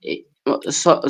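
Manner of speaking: normal talking voice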